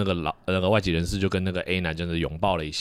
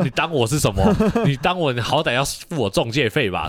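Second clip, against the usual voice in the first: half-joking indignant outburst, a bit louder; a touch louder, mock outrage at being used as a matchmaker; mock indignant retort; 6 semitones higher, 5 dB louder than usual. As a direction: play up the offence, laughing it off. Indignant inner retort, laughter.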